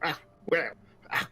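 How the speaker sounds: put-on high, grumpy old barmaid voice; surly old woman. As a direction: scold them like a cranky old innkeeper. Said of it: "a surly looking, quite elderly human woman".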